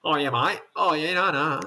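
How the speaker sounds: broad mock-Aussie vowels; imitated Australian accent. Guest showing how locals sometimes talk, imitated Australian accent. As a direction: playfully do a local Australian's chat. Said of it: "And sometimes they".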